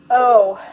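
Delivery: loud exasperated groan; drawn-out, weary "oh". A narrator's reading. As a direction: groan it out in dismay. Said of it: "groaned Joan".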